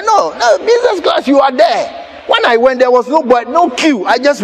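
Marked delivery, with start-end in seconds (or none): 0.0-2.1 calling out. Retold or imitated. Airline staff calling him over.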